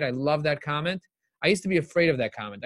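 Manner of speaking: ordinary talk, nothing marked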